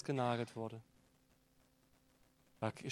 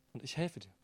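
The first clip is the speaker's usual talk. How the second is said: ich helfe dir said softer and tender; tender reassurance; 8 dB quieter, faster than usual. Pretend. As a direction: offer help softly, like a close friend. Gentle God beside you.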